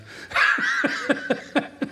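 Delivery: high, unvoiced laugh at his own joke; breathy laugh. Real, not acted. Pastor laughing at his own joke, an airy chuckle. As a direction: let an airy chuckle slip out.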